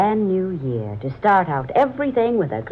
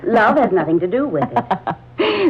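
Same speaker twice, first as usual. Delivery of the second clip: laughing it off, a little higher and slower; exasperated laugh; 6 semitones higher, 4 dB louder than usual. Acted. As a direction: brush off her teasing with a laugh. Exasperated with friend's love talk.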